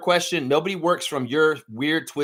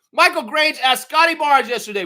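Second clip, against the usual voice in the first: outraged rant; 11 semitones higher, 6 dB louder than usual. Real, not acted. Ranting at reporter.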